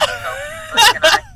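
building to a loud high cry on freaking; rising exasperated complaint; mock outrage. Real, not acted. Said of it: "my car insurance".

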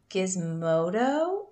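usual speaking voice

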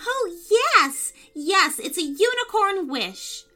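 put-on a little girl squealing yes, yes; excited girl. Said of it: "clapped her hands with excitement".

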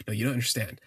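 usual speaking voice

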